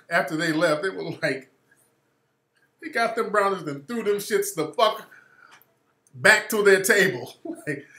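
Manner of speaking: ordinary talk, nothing marked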